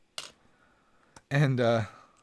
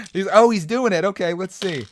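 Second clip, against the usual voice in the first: excited; 7 semitones higher, 8 dB louder than usual. Real, not acted. Reacting to frozen stream bit.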